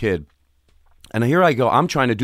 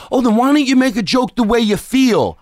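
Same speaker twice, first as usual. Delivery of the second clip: mocking retort; 9 semitones higher, 5 dB louder than usual. Mock retort to a comedian.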